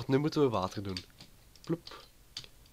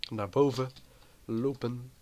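usual speaking voice

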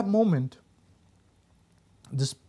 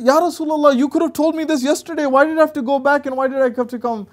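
high, loud, indignant protest; a fed-up mother objecting; exasperated complaint; 9 semitones higher, 5 dB louder than usual. Retold or imitated. Mother protesting to Rasulullah, loud and exasperated.

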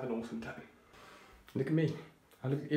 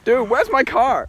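loud; 11 semitones higher, 16 dB louder, slower than usual. Pretend. Quoting the film line loudly.

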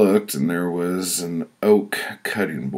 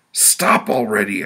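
loud, higher-pitched plea; desperate raised-voice plea; pleading; 6 semitones higher, 6 dB louder than usual. Acted. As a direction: beg for it to stop. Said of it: "I pleaded".

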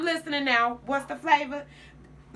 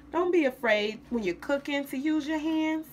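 usual speaking voice

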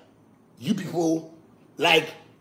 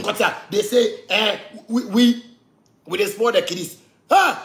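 normal talking voice

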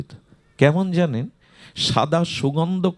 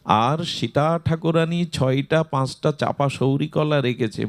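ordinary talk

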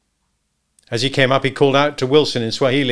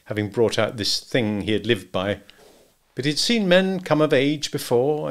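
plain reading voice

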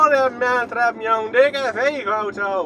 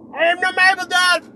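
playful shouting; 7 semitones higher than usual. Pretend. Driver hollering the furniture store's name while filming, shouting. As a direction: shout it out high on one held pitch. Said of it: "Ik schreeuw het".

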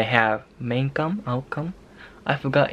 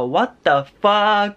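long, drawn-out, high-pitched exclamation; exasperated outburst; 7 semitones higher, much slower than usual. Pretend. Vlogger ranting about the government's mask plan, exasperated. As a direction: stretch each word in theatrical disbelief. Mock outrage over mask policy.

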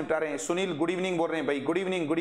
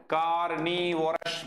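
slow model word; much slower than usual. Shown as a demonstration. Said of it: "तो इधर क्या बोलेंगे बताओ?".

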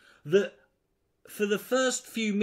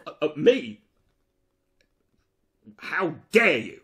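mock offence; 2 semitones lower, 5 dB louder than usual.

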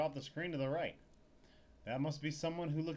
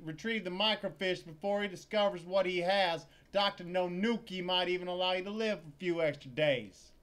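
loud menacing tirade; angry threat; 4 semitones higher, 8 dB louder than usual. Acted. Threatening the clerk about the lost microfiche, loud. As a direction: lean on her, louder and louder.

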